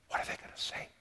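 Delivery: breathless whisper of the waiting angels; a secret, suspenseful whisper; hushed whisper. A narrator's reading. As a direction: whisper it as if afraid to be overheard.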